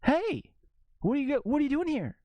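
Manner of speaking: high, chirpy mock greeting, fast at the end; mock Mark Wahlberg. Mocking the actor who shows up in everything, a high, chirpy greeting. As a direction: play him as an eager guy popping up everywhere. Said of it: "he's just".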